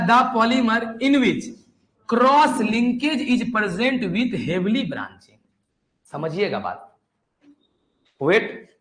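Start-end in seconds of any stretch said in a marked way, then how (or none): 0.1-5.4 slow, higher reading of the definition; slow dictation. Shown as a demonstration. Teacher reading the textbook definition aloud to the class. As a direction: read the definition out slowly for copying. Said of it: "तो सुनो क्या लिखा है".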